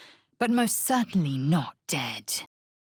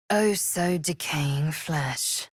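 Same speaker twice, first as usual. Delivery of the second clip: ordinary talk, nothing marked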